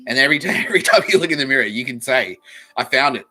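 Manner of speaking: loud, worked-up comic tirade; hammed-up raving; ranting. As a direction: push it out loud through a laugh. Ranting threat continues, building up.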